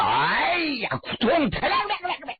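high yelp, then imitated thud and clatter; storyteller's high-pitched falling noises; cry and tumbling fall. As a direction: yelp as you topple, then rattle out the thumps.

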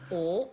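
鹅 for 河, no h sound; Changsha pronunciation. Shown as a demonstration. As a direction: echo 鹅 as Changsha people say 河. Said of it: "长沙话就斗鹅苗". (用长沙话把河念成“鹅”)

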